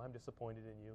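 quietly disappointed, voice dropped right down; calm disappointment. Retold or imitated. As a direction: imitate a father's calm, hushed reproof. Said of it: "He would just calmly say".

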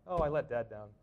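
normal talking voice

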